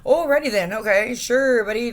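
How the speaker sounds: loud, high scoffing alrighty then; a loud sarcastic brush-off to the cops; sarcastic retort. Real, not acted. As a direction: throw it back at them mockingly.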